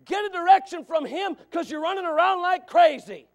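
loud, high preacher's push; full-force preaching. Acted. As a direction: drive the point home hard.

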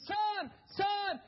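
high, loud desperate scream. Retold or imitated. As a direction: cry out to a son who can't hear. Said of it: "And he screamed".

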